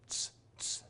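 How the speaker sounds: "Tss, tss" as a hissed, voiceless ts; whispered ts sound. Shown as a demonstration. Teacher demonstrating the zeta sound to students, voiceless. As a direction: hiss "Tss" with no voice. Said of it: "It's a T S sound".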